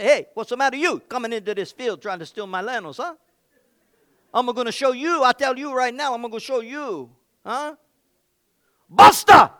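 hammed-up Italian warrior defending his field; mock Italian bluster; Italian accent. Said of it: "maybe he got a little Italian".

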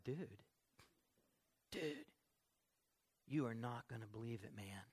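lightly imitated dude talk, low and soft; a hint of a mellow, amazed friend; laid-back dude. Friend reporting back about K-Love, lightly imitated laid-back dude. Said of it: "people still talk like that".

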